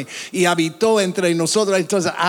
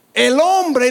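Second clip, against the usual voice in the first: normal talking voice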